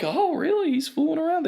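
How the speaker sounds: very high, exaggerated gasp of surprise; mock surprise. Emcee faking shock at the gossip, squeaky high. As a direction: play it up like shocking news. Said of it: "I'm like".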